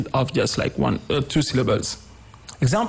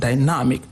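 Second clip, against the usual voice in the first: dynamic with the weight on NAM; stress on second syllable. Shown as a demonstration. Teacher repeating the corrected word, second-syllable stress. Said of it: "The second syllable".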